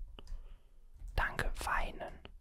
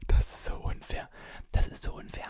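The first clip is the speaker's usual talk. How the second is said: louder, indignant whisper; frustrated whisper; 8 dB louder, faster than usual. Real, not acted. Complaining to viewers the game cheated, frustrated whisper. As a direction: protest the unfairness in a forceful whisper.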